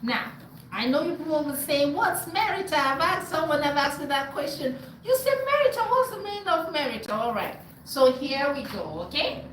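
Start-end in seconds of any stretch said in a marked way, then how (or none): none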